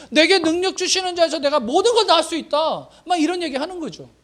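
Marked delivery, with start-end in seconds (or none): 0.1-2.9 high, fervent proclamation; fervent declaration. Retold or imitated. 설교자가 신약 말씀을 선포하며, 높고 힘차게. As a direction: declare it with rising conviction. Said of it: "신약 시대로 말하면".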